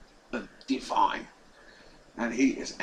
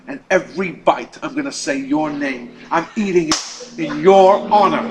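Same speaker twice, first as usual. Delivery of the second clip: building louder to a spiteful finish; defiant taunt; 9 dB louder than usual. Retold or imitated. Angry professor taunts the rabbi over the phone, building louder. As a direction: rub it in, getting louder.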